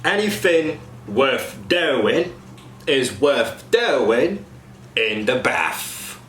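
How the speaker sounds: slow Cockney, "anything" as anyfing, "bath" as baf; slow Cockney example. Shown as a demonstration. Coach demos TH fronting to cast. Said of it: "Example sentence".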